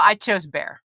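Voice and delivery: ordinary delivery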